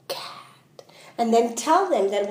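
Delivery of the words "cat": soft unvoiced whisper. Shown as a demonstration. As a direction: breathe the word out silently. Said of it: "to sound it out silently".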